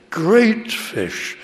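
"Great" lifted high and weighted; stress on great. Shown as a demonstration. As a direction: hit "Great", let "fish" fall away. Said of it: "It's emphasized".

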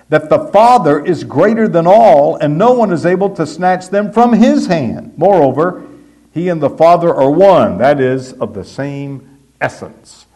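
high, loud pulpit build; fervent preaching. Acted. Preacher declaring the sheep are safe, fervent. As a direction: preach it home with fire.

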